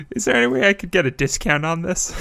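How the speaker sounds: fast, laughing through words; giggling while asking. Real, not acted.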